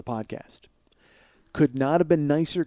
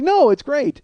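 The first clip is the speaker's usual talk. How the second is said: high-pitched imitation of a keen fan; eager reassurance; 10 semitones higher, 4 dB louder, slower than usual. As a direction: pitch it up, mock-enthusiastic.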